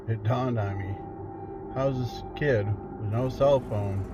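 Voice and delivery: usual speaking voice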